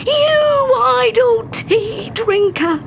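crowd crying out. A narrator's reading. Horrified crowd crying out at the tea drinker. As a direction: scold her as a horrified crowd. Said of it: "cried the crowd".

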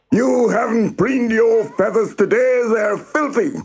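high-pitched cockerel scolding; shouting. A narrator's reading. Cockerel inspects Henrietta's dirty feathers, shouting. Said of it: "First he shouted at Henrietta".

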